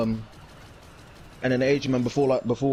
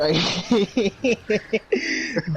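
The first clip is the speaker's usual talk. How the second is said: squealing high laugh; high burst of laughter; 10 semitones higher than usual. Real, not acted. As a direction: crack up high and bright. Cracks up before Baby Yoda voice.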